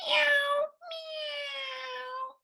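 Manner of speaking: very high thin meows; kitten meow. A narrator's reading. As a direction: mew like a restless kitten.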